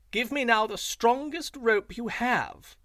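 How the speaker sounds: high, loud, showy jackal call. A narrator's reading. Jackal calls loudly to his wife so Lion hears, high and showy. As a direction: make sure Lion overhears the fake order. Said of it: "Jackal said aloud".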